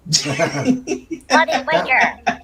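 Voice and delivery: mock British accent through laughter; laughing British slang. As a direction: giggle through a put-on British insult. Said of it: "attempts to speak British".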